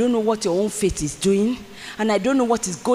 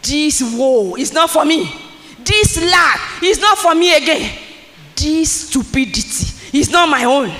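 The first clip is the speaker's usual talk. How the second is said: louder and higher rising proclamation; bold declaration; 6 semitones higher, 5 dB louder than usual. Real, not acted. Preacher rejecting woe before the congregation, loud and rising. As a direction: declare it with force. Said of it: "declaring against lack".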